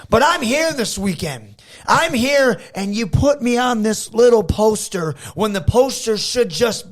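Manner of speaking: the arrogant hometown star lording it over everyone; heel promo. Shown as a demonstration. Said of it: "Hopefully I'm a heel".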